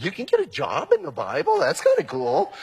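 high, wide-eyed naive newcomer voice; naive newbie. Retold or imitated. First-time Bible reader delighted by his discovery, high and naive. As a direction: lift way up into giddy innocent wonder. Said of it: "and you go".

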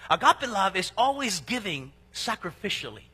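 ordinary talk, nothing marked

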